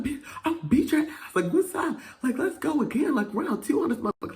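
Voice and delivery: high-pitched, loud taunting; retold fight challenge. Challenging the other fighter to round two, loud high taunt. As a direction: squawk it like calling someone out.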